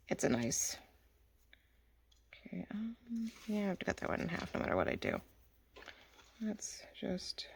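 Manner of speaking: soft whisper to herself. Real, not acted. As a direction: murmur under the breath. Whispering while crafting.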